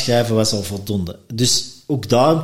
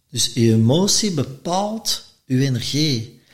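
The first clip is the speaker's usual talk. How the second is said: usual speaking voice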